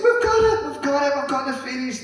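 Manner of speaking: very high, squealing with excitement, starting loudest and then settling; excited girls. Retold or imitated. Girls running back to their father, squealing with excitement. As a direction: burst in shrieking the news of the fairies.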